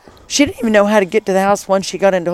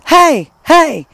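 loud, higher call-out to a passerby; imitated yell; 8 semitones higher, 6 dB louder than usual. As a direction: holler to flag someone down, as she did. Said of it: "but she's yelling, 'Hey, hey,' at us".

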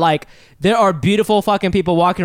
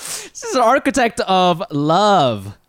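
announcer voice; much slower than usual. Acted. Grand guest introduction.

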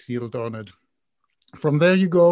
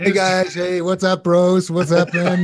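loud, higher mock California bro voice; hammed-up California dude greeting; Californian accent; 4 semitones higher, 7 dB louder, slower than usual. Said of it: "doing his Californian accent".

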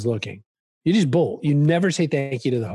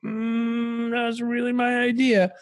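high, slow, airy mock-thoughtful musing; half-joking self-impression, lifted high and slowed; mock smug inner voice; 10 semitones higher, much slower than usual. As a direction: play the slightly put-out inventor, drawn out and high. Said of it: "I'm like".

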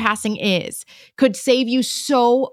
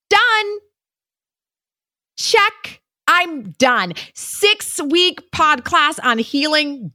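mock checklist; 6 semitones higher, slower than usual. Mocking checklist of healing.

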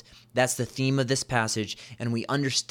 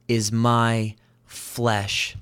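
"is my flesh" drawn out very slowly, each word weighted; slow right down and land "is my flesh"; slow emphatic reveal; much slower than usual. Shown as a demonstration. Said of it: "watch this".

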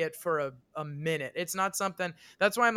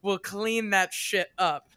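stepmom laying down law; 3 semitones higher, 7 dB louder than usual. Retold or imitated. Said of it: "Good for her to be like".

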